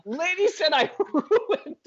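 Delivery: lachend uitgesproken, langzaam en hoog, met een lach in het midden; laughing. Real, not acted. Laughs mid-sentence at own line.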